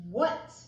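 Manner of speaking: what? called out loud, rising; emphatic what. Shown as a demonstration. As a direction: announce the word what?. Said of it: "There's who".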